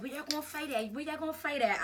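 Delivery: mimicked kids keen for a fight; imitated eager classmates. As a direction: pester like an excited bystander.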